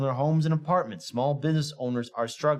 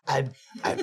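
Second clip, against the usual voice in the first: demon voice. Retold or imitated. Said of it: "She sounded like an evil little demon".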